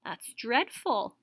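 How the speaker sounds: ordinary delivery, nothing marked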